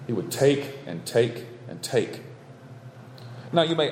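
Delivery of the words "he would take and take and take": each "take" in "He would take and take and take." hammered slowly; slow repeated take. Shown as a demonstration. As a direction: lean hard on every "take", slow and deliberate. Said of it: "the word that was being repeated".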